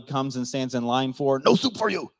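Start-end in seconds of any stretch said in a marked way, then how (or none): 1.4-2.1 high, abrupt catchphrase impression; curt mock refusal, pitched up; Soup Nazi. Preacher quoting a Seinfeld catchphrase, mock-stern impression. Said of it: "it's not the soup Nazi".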